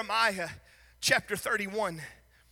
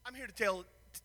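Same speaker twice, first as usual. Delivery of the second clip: ordinary delivery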